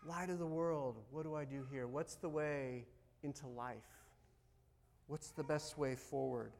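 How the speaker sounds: low, subdued praying voice; quiet prayer. Acted. Believer asking the light of the world, quiet prayer. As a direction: ask softly and humbly for guidance.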